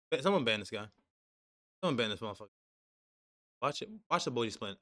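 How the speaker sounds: ordinary talk, nothing marked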